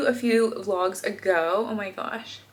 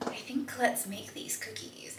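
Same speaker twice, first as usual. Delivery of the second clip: whispering; 13 dB quieter than usual. Real, not acted. Quiet aside before baking.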